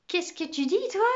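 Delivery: teacher startled by a pupil's remark; shocked question. Acted. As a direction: catch the boy out in disbelief.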